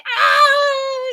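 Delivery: high, loud mock wail of pain; hammed-up childbirth cry; imitated labor scream. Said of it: "not like that. It didn't sound like that. It was a lot worse".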